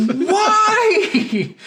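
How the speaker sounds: high, loud wail breaking into a laugh. Retold or imitated. Player despairing at the gang member, laughing high wail. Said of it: "And you're like".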